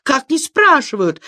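high-pitched outraged question; indignant wail. A narrator's reading. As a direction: wail it in disbelief. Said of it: "завопил Саранин". (возмущённый вопль)

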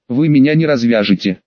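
usual speaking voice

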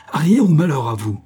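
high, loud screamed threat; yelled threat. A narrator's reading. The hostess threatens the men from the ladder, loud high yell. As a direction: curse them as you yell. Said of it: "hurla-t-elle".

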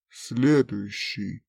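low, slow cold order; slow villain command. Acted. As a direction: drawl it out coldly. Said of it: "villain orders next victim". (медленный низкий приказ)

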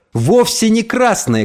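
high, drawn-out cry of protest; tearful angry cry. A narrator's reading. Boy protests to his mother about his face, tearful angry cry. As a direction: cry out, choking on anger and tears. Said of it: "крикнул я, задыхаясь от гнева и слёз".

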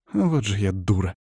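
a touch of rising hysteria; incipient hysteria. Acted. Witch blames herself after the operation, faintly hysterical. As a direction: scold yourself, close to hysterics. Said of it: "Завибрировал её голос от начинавшейся истерики".

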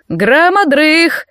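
high, slow coach's call; drawn-out announcement. A narrator's reading. Trainer announcing the next exercise to the cadets, drawn-out announcement. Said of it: "объявил тренер".

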